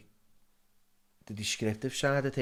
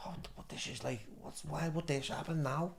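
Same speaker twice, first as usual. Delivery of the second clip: hushed inner thought; 4 semitones higher, 11 dB quieter than usual. Retold or imitated. Retold own thoughts on hearing of the riots.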